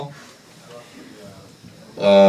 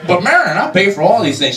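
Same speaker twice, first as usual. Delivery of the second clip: protesting George; 6 semitones higher than usual. Retold or imitated.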